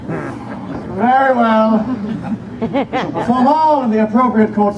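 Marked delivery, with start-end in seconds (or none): 0.1-1.8 drawn-out very slow concession; grudging. Acted. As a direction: concede with a long sigh.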